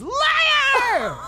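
very high, loud, stretched-out heckle; retold scream. Drunk girl pointing at a stranger at a concert, screaming. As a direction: imitate a drunk girl's piercing accusation. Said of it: "This drunk girl points at me and screams".

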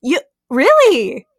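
high-pitched, rising disbelief; incredulous. Real, not acted. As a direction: keep asking in mounting disbelief, voice jumping up. Incredulous that nobody knows SCP.

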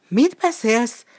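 high, loud startled gasp of a question; frightened stammer. A narrator's reading. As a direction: blurt it out in fright. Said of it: "hebegte rémülten a farkas". (ijedt, magas, hangos hebegés)

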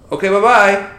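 sing-song brush-off "Okay, bye-bye"; chirpy flight attendant. Retold or imitated. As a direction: mimic the dismissive agent. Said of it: "I'm like".

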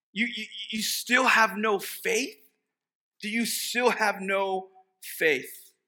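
high, loud, slow incredulous rebuke; exasperated Jesus. Acted. Jesus reminding disciples of his miracles, exasperated. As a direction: press them, pleading and indignant. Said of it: "Then he says".

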